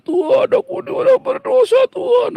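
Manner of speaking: shrill, very high whiny voice, hammed up; mocking fake prayer. Preacher mocks a fake penitent praying, very high whine. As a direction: parody a showy penitent praying. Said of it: "Setiap kali Anda berdoa".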